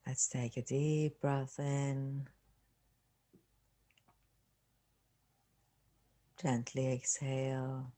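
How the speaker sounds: unhurried meditation voice with long pauses; guided meditation. Acted. Teacher leading listeners through relaxing the body, guided meditation. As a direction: calmly guide them through a body scan, leaving space.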